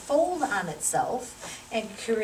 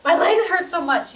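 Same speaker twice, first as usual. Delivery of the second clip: mock whiny patient, higher and louder; complaining patient; 7 semitones higher, 9 dB louder, slower than usual. Patient moaning about sore legs, a mock whine. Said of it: "start complaining and going".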